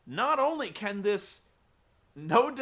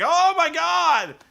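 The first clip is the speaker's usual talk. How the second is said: much higher, louder, slow shriek of horror; mock horrified cry; 11 semitones higher, 12 dB louder, slower than usual. A wrestler seeing his bloodied dad in the mirror, hammed-up shriek. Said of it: "and he's like".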